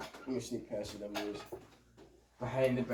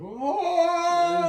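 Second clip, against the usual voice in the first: amazed whoa; 13 semitones higher, 5 dB louder than usual. Retold or imitated. Friends amazed at bottle flip.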